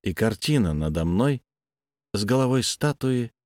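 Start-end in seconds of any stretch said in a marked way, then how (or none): none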